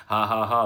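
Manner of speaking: mock laugh.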